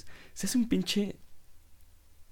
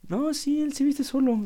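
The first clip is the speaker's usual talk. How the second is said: much higher, mocking falsetto mom; imitating mom; 7 dB louder than usual. Son mimicking his mom answering the optician, high mock falsetto. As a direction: squeak it out like a doting mother. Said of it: "mi mamá así de".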